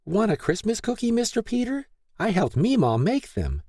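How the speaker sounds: put-on high, eager child voice; excited little girl. A narrator's reading. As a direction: squeal it like an excited preschooler. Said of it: "The little girl squealed in excitement".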